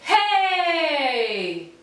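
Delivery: long loud hey, sliding down; projected hey. Shown as a demonstration. Coach calling hey with the class, loud. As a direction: toss the sound at the far wall. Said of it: "with me".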